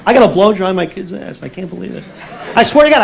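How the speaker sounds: ordinary delivery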